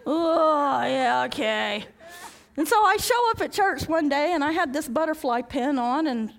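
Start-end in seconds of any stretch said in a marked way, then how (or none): 0.1-1.8 slow, laughing mock whimper; mock-tearful okay; laughing reluctance. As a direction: give in while laughing.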